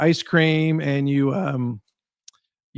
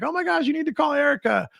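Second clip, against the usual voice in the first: much higher, bubbling enthusiasm; mock gushing fan; 12 semitones higher than usual. Raving fan telling a friend to call Erica, much higher and excited. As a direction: rave about her like a true fan. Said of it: "And they're like".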